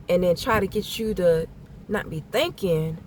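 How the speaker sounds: ordinary talk, nothing marked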